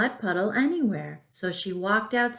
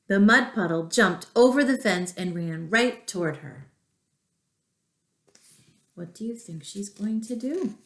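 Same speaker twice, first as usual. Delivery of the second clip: usual speaking voice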